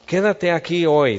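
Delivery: David commanding. Acted.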